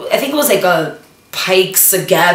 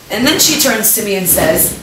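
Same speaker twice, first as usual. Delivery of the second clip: ordinary talk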